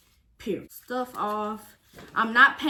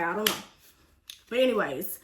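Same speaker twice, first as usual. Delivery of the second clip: ordinary talk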